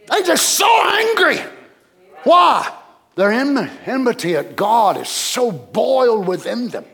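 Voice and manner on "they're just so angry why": high, insistent pulpit shout; urgent preacher's cry; emphatic preaching. Real, not acted. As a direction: punch it out with force. Preacher driving the point home.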